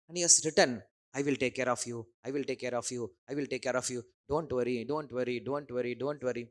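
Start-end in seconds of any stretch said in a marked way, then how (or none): 1.1-6.4 soothing Krishna promise. Acted. Krishna's written promise voiced softly.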